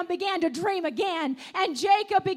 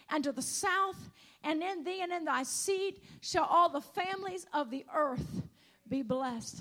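solemn scripture reading; 2 semitones lower, 7 dB quieter than usual. Acted. Preacher reading Genesis to the congregation, softly reverent. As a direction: read the promise gently, with awe.

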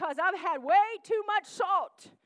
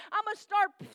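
ordinary delivery

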